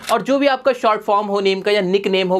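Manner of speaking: ordinary talk, nothing marked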